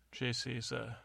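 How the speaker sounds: ordinary delivery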